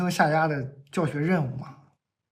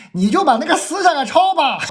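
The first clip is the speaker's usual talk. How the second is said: mom's voice, much higher and louder; egging the kid on like an impatient mother; imitating mom; 9 semitones higher, 7 dB louder than usual. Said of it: "我妈在后面".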